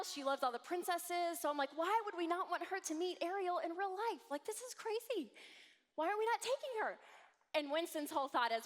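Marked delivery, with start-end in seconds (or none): 1.7-7.0 high, pleading, disbelieving; incredulous excited plea. Retold or imitated. Wife pleading with husband over Disney, excited disbelief. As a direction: argue like it's obvious, pitch up. Retelling her own pleading argument.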